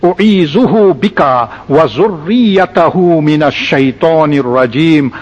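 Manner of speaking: slow, drawn-out Arabic recitation; slow dua recitation. Shown as a demonstration. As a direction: recite the dua slowly, stretching the long vowels. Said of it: "then you make this dua".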